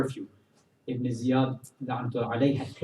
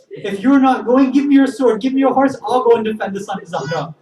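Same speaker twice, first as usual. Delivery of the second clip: loud, high, impassioned demand; defiant outburst; 10 semitones higher, 10 dB louder than usual. Acted. Wife offers to go fight in her husband's place, defiant outburst. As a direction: cry it out as a fierce challenge.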